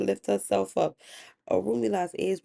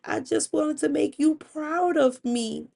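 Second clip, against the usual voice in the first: tearful apology; 8 semitones higher than usual. Acted. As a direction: act a meek, remorseful wife pleading with her husband. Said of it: "She looked at her husband with blurry eyes".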